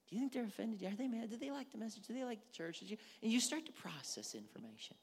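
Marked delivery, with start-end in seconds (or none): 0.1-3.0 soft, rapid-fire inner questions; worried second-guessing. Acted. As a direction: fret quietly to yourself. Anxious inner second-guessing acted out.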